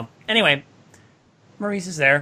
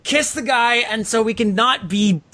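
desperate servants; 6 semitones higher than usual. Retold or imitated. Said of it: "you just want to be like".

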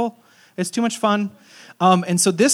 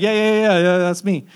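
loud, higher-pitched imitation of an excitable regular guy; donut shop guy; 3 semitones higher, 7 dB louder than usual. Donut shop guy answering pastor at car window, loud imitation. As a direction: rattle off the yeahs loudly, imitating him. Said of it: "That's how he talks".

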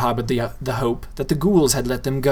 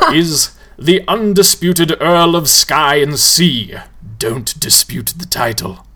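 louder, showy ghoul host; grand ghoul; 3 semitones higher, 5 dB louder, slower than usual. A narrator's reading. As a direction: present them like a showman. Tall ghoul introduces.

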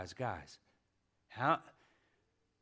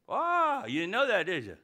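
teasing; 7 semitones higher, 5 dB louder than usual. Real, not acted. Teasing the congregation.

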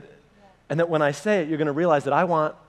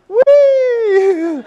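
loud, high, long squeal; mock gleeful whee; 7 dB louder than usual. Preacher pretending to toss baby back, squealed whee. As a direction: let out a comic high whee. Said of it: "I was like".

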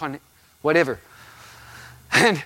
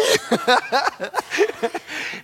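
high laughter; 10 semitones higher than usual. Real, not acted. Laughing fit.

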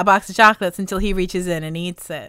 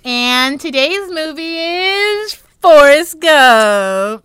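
slow, loud, rising showman reveal; slow, booming build up to the title; grand announcement; 8 semitones higher, 10 dB louder, much slower than usual. Acted. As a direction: announce the movie like a gameshow host. Podcast host announces the movie.

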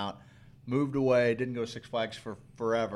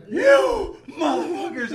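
high, loud, drawn-out mock scream; imitated scream; 10 dB louder, slower than usual. Retelling the wife's scream on the ride, loud and high. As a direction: scream it like she's being shot backwards. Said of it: "She's losing her breath".

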